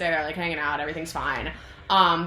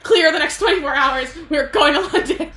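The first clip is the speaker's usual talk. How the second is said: loud, high, emphatic command; urgent acted order; 9 semitones higher, 7 dB louder than usual. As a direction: call out the order, hammed-up urgency. [name] acted ordering Cash about trip.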